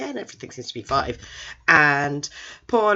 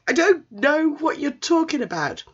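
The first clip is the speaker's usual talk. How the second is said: high, mock-baffled protest; raised voice of comic disbelief; exasperated denial; 13 semitones higher, 4 dB louder than usual. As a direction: protest in hammed-up bewilderment. Baffled teacher denies everything.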